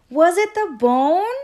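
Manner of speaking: high, loud, urgent question; hollering. A narrator's reading. Impatient dog demanding the story's ending from the bird, hollering. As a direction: holler it with a big rising end. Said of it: "Rocket hollered".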